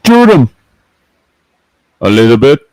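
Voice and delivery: loud, high Cajun shout; Cajun impression. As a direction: bark the order like a swamp hunter. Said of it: "Toy Landry impression".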